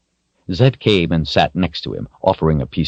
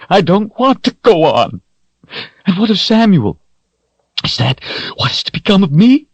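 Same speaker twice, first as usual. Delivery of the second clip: much higher, louder anguished cry; 5 dB louder than usual. A narrator's reading. Richard refuses to go on, crying in anguish. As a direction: wail it out in despair.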